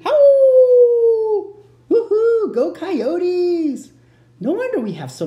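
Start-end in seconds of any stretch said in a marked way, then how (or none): 0.0-1.6 long, high, loud coyote howl. Pretend. Imitates a coyote after naming it. 1.9-3.9 high, loud whooping cheer; excited cheer. Pretend. Cheering on the school's namesake.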